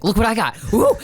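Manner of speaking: mock triumphant show-off with a whoop; playful taunt. Retelling the hero holding the cat up, mock gleeful taunt. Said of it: "He's like".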